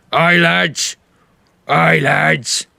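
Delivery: a hint of a big orc first mate; terse orc, voiced lightly; huge orc. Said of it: "barrel-chested, huge orc".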